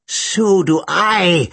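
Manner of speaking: slow, drawn-out snarl; long menacing snarl. A narrator's reading. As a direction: snarl it slowly, stretching the words. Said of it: "Boyle snarled".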